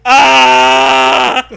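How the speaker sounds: sudden loud hammed-up yelp of fright; loud pretend scream; mock scared scream. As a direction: yelp as if the bus just appeared. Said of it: "Rod Serling is scared".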